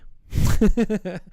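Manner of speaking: high, loud burst of laughter; amused high laugh. Real, not acted. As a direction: laugh at your own joke. Laugh after the joke.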